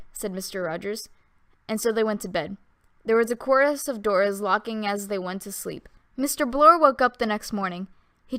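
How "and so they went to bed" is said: usual speaking voice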